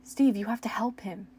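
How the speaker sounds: loud strained plea; shout. Acted. Dustin begging Steve to save Eddie, loud strained shout. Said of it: "Dustin shouted".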